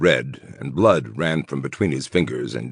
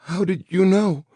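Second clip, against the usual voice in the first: put-on very high, timid girl voice; frightened little girl voice. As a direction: ask it like a scared child. Scared woman answers villain.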